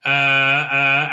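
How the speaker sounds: ordinary talk, nothing marked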